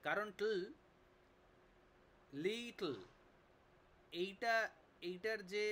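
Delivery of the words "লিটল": লিটল with a long, high first syllable, falling on the end; stress on first syllable. Shown as a demonstration. Teacher demonstrates stress to class, লিটল with its first syllable stressed. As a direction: lean on the start of লিটল, drop the ending. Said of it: "লি এর ওপরে".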